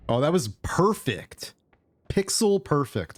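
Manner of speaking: delighted at a perfect move; excited. Real, not acted. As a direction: pitch jumps up, thrilled. Nails a precise dig.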